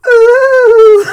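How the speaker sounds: very high, very loud owl-like hoot; shrill hoot. Real, not acted. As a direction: let out a wild squawking hoot. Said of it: "What the fuck was that?".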